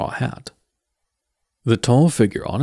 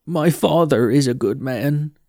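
put-on young Mort; 4 semitones higher, 3 dB louder than usual. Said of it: "he said".

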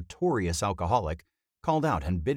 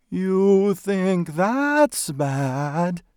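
very slow, high ghostly wail; disembodied ghostly voice; 13 semitones higher, 7 dB louder, much slower than usual. A narrator's reading. Unseen voice behind the undertaker's shoulder, ghostly wail. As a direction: wail it out like a spook, drawn long. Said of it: "a disembodied voice echoed softly".

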